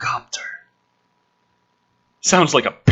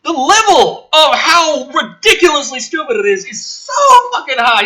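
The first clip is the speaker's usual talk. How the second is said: high-pitched, loud ranting in disbelief; near-yelling incredulous tirade; exasperated rant; 8 dB louder than usual. Real, not acted. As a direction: rant at how dumb it is. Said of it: "I spent so long yelling".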